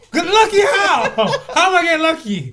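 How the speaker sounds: high laughing disbelief. Real, not acted. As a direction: laugh through the question.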